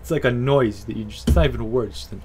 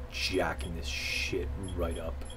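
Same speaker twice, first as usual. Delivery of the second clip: whisper; 8 semitones lower, 12 dB quieter, slower than usual. Real, not acted. Whispered aside while tweaking settings.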